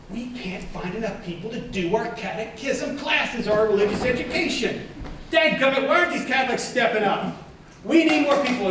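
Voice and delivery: high-pitched, worked-up complaint; fretful mock rant, pitched well up; exasperated pleading. Parish staff lamenting the lack of volunteers, exasperated.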